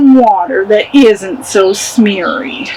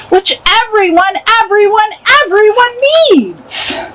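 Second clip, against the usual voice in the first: building from a mock rant to a high, fast yell; yelling at the Lorax; 5 semitones higher than usual. The Onceler yelling at the Lorax, building higher and faster. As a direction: rant back and climb higher with each biggering. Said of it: "I yelled at that Lorax".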